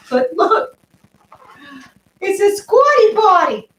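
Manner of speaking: high, thrilled unveiling of a squatty potty; excited reveal. Real, not acted. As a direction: show it off with a big squeal of delight. Unboxing reveal to the viewers.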